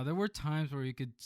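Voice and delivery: usual speaking voice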